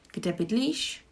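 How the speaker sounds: Kde bydlíš with the pitch rising on bydlíš; rising question tune. Shown as a demonstration. Teacher models the question for learners. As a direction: let bydlíš go up at the end. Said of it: "intonation is rising".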